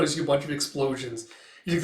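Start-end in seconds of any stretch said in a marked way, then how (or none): none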